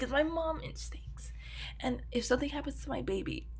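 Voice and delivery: soft whispered confession; whispered aside. Real, not acted. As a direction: whisper it like a secret. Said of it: "confiding aside".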